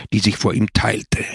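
usual speaking voice

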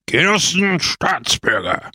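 proclaimed public salutation; orator's shout. A narrator's reading. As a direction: hail the assembly like a political orator. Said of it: "schrie Pepone".

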